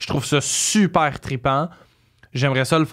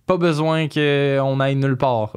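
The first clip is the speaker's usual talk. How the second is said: usual speaking voice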